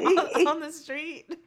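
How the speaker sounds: loud, slow words through laughter; laughing. Real, not acted. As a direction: struggle to finish while cracking up. Cracking up at the scene described.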